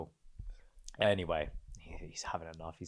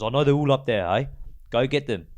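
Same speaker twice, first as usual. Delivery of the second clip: loud, raised insistence; angry insisting; 4 semitones higher, 7 dB louder than usual. Retold or imitated. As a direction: push back hard at the staff. Uncle insisting again.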